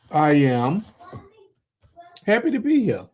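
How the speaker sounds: a leap up in pitch on happy to be here, beaming; excited host. Real, not acted. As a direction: sound thrilled to be on air. Host opening his own podcast.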